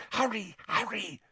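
high, urgent, very soft whisper; mouthed whisper. Acted. Hermione urging Harry on from behind a tree, mouthed whisper. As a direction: whisper it almost soundlessly. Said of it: "she mouthed".

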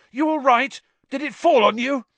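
high, loud worried calls; worried shout. A narrator's reading. Cedric calling to injured Harry, worried shout. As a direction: shout to check he's alive. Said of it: "he heard Cedric shouting".

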